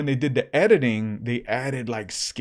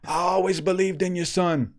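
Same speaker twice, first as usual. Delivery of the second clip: higher, starstruck gushing; excited kid; 6 semitones higher than usual. Retold or imitated. Retelling Levi thrilled the preacher called in, excited. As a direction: gush like a thrilled fan. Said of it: "he gets super excited".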